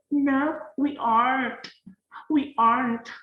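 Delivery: show-off Western aren't, swallowing the t; exaggerated native accent. Shown as a demonstration. Teacher hams up a native aren't to tease students. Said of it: "Oke, kayak gitulah ya".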